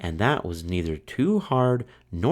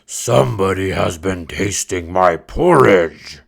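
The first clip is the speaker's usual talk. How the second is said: big loud bear voice; great huge bear voice; 7 dB louder than usual. Acted. Great huge bear finding his porridge touched, loud bear voice. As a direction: boom it out like the biggest bear. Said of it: "said in his great, huge voice".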